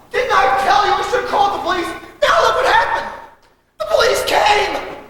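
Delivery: high-pitched I-told-you-so wail; panicked blame. Acted. Panicked blame as police arrive.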